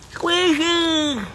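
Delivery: high sing-song call. Real, not acted. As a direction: call her name from across the yard. Calling chipmunk from afar.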